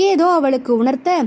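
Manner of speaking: ordinary delivery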